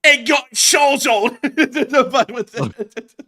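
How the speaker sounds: real laughing outburst; laughing shout. Real, not acted. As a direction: burst out laughing mid-yell.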